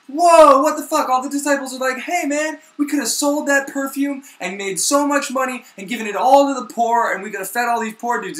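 high, loud startled whoa; shocked outcry. Retold or imitated. Disciples shocked by the perfume, high and loud. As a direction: gasp and scold at the waste.

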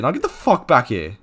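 ordinary talk, nothing marked

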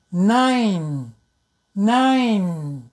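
"Nine" with a clear nasal n at start and end; careful demo word. Shown as a demonstration. Teacher modelling the word nine slowly. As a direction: stretch the n sounds in "Nine". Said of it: "like this".

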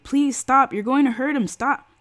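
high, loud begging; desperate plea. A narrator's reading. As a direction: plead with them to stop. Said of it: "she pleaded".

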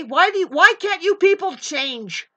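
ordinary talk, nothing marked

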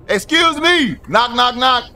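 high, loud hail at a door; calling out. Pretend. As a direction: call to be let in. Calling at a locked apartment door.

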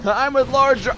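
loud, high-pitched yell. Pretend. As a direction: shout your allegiance as you run by. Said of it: "Start yelling as you run by".

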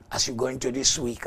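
normal talking voice

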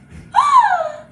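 loud, high, voiced mock gasp; amazed gasp. Teacher reveals a painted kitty to children, amazed gasp. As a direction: gasp big in pretend amazement.

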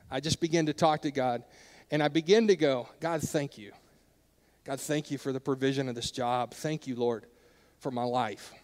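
soft, low grateful prayer; quiet prayer. Retold or imitated. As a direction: murmur thanks to God softly.

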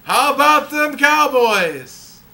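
drawn-out mock fan cheer; punchline Texan yell. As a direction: belt it out like a proud Cowboys fan. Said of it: "And he punches him on the arm".

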